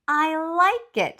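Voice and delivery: pleased, slow and gentle approval; lightly voiced Mama Bear. Mama Bear approving Baby Bear's wooden bench idea, lightly voiced and slow.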